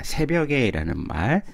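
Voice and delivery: normal talking voice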